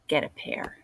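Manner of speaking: ordinary delivery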